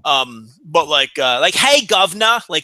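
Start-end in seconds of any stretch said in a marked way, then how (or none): 1.5-2.4 higher, louder mock Cockney accent; old London British accent. Shown as a demonstration. Mocking uneducated British accents to a co-host, old London accent. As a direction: hail someone in a hammed-up Jack the Ripper-era London accent. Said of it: "Jack the Ripper era accents".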